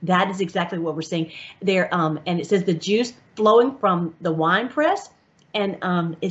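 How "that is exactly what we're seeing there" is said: normal talking voice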